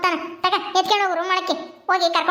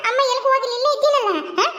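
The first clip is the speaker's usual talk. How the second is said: pitched-up soothing mother's coo; 6 semitones higher than usual. Real, not acted. Mother reassuring crying baby.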